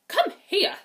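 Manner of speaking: sharp, loud and high stepmother summons; a cold stepmother calling loudly; stern stepmother. Acted. Stepmother orders Cinderella over, loudly and sharply. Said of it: "the stepmother said".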